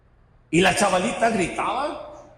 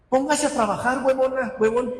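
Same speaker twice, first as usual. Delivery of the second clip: sharp, higher scolding burst; mock scolding; 8 semitones higher than usual. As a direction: scold the lazy one teasingly. Mocking lazy prayer, scolding. (regaño burlón y agudo)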